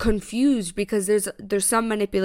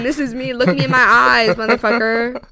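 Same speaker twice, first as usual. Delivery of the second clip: loud, hammed-up confrontation; heated, retold challenge; defiant demand; 2 semitones higher, 8 dB louder than usual. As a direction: retell it loudly, eyes wide and insistent. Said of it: "eyebrows was up".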